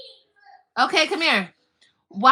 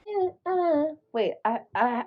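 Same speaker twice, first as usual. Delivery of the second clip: normal talking voice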